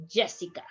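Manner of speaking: "Jessica" with J between sh and y; soft J. Shown as a demonstration. Teacher models the first sound for students, soft J pronunciation. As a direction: begin "Jessica" on a soft English J. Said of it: "something in between sh and y".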